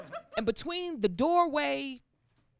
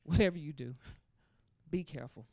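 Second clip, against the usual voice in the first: low, soft motherly warning; cautioning mother; 7 semitones lower, 6 dB quieter than usual. Retold or imitated. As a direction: warn quietly, with grave pauses. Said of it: "She's like".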